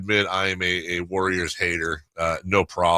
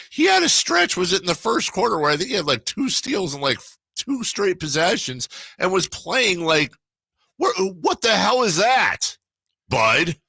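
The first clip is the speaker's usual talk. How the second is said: excited; 11 semitones higher than usual. Real, not acted. Excited retelling of Buddy's stretch.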